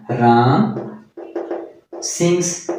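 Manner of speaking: Ram, pause, then sings said slowly and apart; teacher spelling out Ram sings word by word for students; slow dictation. Shown as a demonstration. Teacher dictating Ram sings to the class, spaced and slow. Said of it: "Ram sings हो गया".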